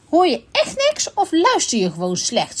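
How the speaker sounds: high-pitched, irritated outburst, only mildly shouted; exasperated shout. A narrator's reading. Unicorn fed up with the raccoon's hearing, mild high shout. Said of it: "schreeuwde de neehoorn".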